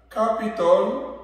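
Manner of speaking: careful teacher model; English word demo. Shown as a demonstration. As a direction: say "capital" slowly with a rise. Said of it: "on va dire".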